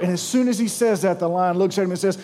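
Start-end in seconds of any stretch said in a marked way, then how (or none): none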